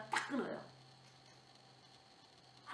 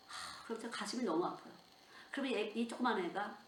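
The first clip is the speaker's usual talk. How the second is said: usual speaking voice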